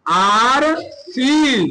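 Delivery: RCN very slow, each letter held; RCN drawn out, as if writing along; slow spelling-out. Shown as a demonstration. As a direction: stretch out every letter of RCN. Formula repeated slowly while writing.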